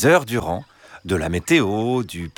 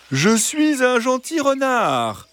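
gentle, reassuring fox; slower than usual. Acted. Fox asking three hens to let him in, gentle and reassuring. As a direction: coax them sweetly in a high drawn-out voice. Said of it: "Une voix douce et rassurante".

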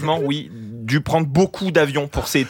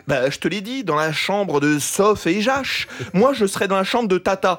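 ordinary talk, nothing marked

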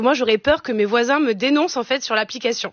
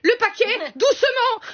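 high-pitched, panicky order to the courier; panicked calling through door; 9 semitones higher than usual. Acted. Scared customer to courier behind the door, high panicked calling. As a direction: call out in a paranoid panic. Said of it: "Je lui parlais derrière la porte".

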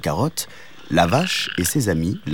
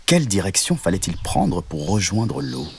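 ordinary delivery, nothing marked

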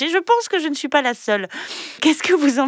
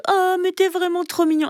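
put-on high cooing baby-talk voice; sugary, high-pitched pet talk; high cutesy voice; 7 semitones higher than usual. Shown as a demonstration. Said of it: "plutôt que".

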